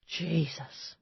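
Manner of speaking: breathy hushed oath under the breath; under her breath. Acted. Aide to herself, hushed under her breath. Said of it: "she said under her breath".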